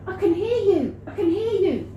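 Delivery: high mock calling; hammed-up, insistent high voice; excited boss. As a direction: imitate him crying out urgently, voice way up.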